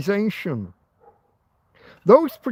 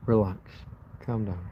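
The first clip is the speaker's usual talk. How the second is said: calming himself; 9 semitones lower, 8 dB quieter than usual. Real, not acted. Angry vlogger telling himself to relax, low and soft. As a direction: lower and much softer, talking himself down. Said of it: "I'm calming down".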